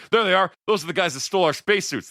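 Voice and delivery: high loud accusing shout; shouting accusation. Retold or imitated. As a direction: yell the accusation high and fast. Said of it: "they're like".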